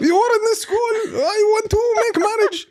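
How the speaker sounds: very high, loud laughing fan imitation; hammed-up fan voice. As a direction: squeal it like an eager admirer. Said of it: "They're like".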